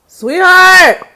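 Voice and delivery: loud call for her sweetheart. Real, not acted. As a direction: yell to someone in another room. Calling partner from another room.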